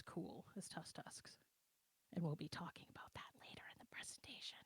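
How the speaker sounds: hushed joking aside; whispered aside. Real, not acted. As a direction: whisper it as a quick self-mocking aside. Joking aside under breath.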